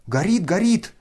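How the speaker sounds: high loud alarmed shout. A narrator's reading. The whole crowd shouting that it burns. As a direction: cry out in alarm. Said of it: "закричали все в один голос".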